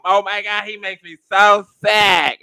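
put-on high, slow drawn-out girl's whine; impression of Samantha. Mocking Samantha complaining, high and slow. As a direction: stretch it out in her high voice. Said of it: "That's exactly how she sounds".

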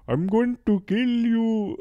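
high, loud, hammed-up killer threat; Swedish butcher. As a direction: call out a mock murderous Swedish threat. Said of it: "they bring out the Swedish butcher".

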